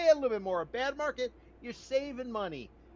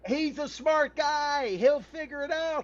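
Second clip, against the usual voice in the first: sarcastic; 6 semitones higher, 4 dB louder than usual. Real, not acted. Sarcastic jab at developer's financing.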